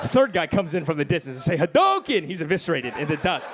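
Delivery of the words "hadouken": loud high fireball yell; hadouken yell. Acted. Comic shouting his special move at the third guy. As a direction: shout it like a fighting game move.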